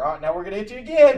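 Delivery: upbeat doctor. Retold or imitated. Medic warning patient of another shock, upbeat. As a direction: rattle it off loudly like an eager medic. Doctor voiced in retold story.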